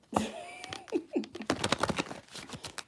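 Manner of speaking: shrieking cackle; high squealing laugh. Real, not acted.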